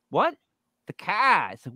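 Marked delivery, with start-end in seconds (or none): 0.9-1.5 "car" said "cah" with no R, high; Boston accent. Retold or imitated. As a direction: drop the R like Boston. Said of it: "Boston accent".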